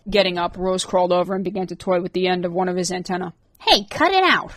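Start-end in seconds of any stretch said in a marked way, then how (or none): 3.6-4.5 much higher irritated protest; annoyed snap. A narrator's reading. Rorik snapping at Rose for toying with his antennae. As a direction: swat her away with your voice.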